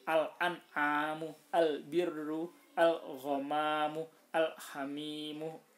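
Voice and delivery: slow, loud, drawn-out Arabic recitation of each word with the al- clearly sounded. Shown as a demonstration. Teacher reciting examples of qamariyah letters to students, slow and clear. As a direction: carefully recite each example word slowly with the lam pronounced. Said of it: "dibaca terang".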